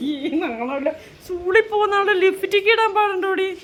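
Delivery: high, slow mock scolding of a schoolgirl; a pretend stern mother, drawn out and high. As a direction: wag a playful finger at her, high and slow. Teasing scold about lipstick.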